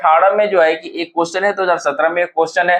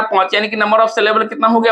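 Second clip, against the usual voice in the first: ordinary talk, nothing marked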